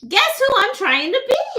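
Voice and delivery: high-pitched Harley Quinn whine. Pretend. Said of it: "Harley Quinn!".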